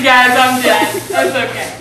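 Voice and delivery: ordinary talk, nothing marked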